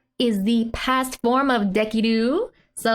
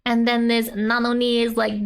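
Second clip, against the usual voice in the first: ordinary delivery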